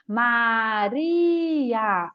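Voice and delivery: MARIA drawn out as MA... RIII... A; slow MARIA with a long held RI; stretched syllables. Shown as a demonstration. Teacher showing a word children split wrongly, slow and stretched. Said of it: "que às vezes confunde".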